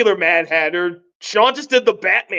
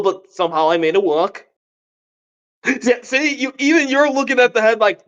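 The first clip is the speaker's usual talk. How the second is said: usual speaking voice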